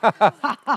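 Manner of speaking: high staged laughter; acted laugh. Actress laughing on the director's cue, acted laugh. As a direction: laugh it out, close to tipping into sobs. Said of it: "Și râde, da?".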